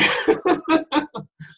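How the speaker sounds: high-pitched real laugh; helpless giggling laugh. Real, not acted. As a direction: burst out laughing. Laughs at own story.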